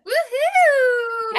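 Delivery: high, loud woohoo; cheering. Real, not acted. Host celebrating guest's new citizenship, excited cheer. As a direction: cheer her on like a fan.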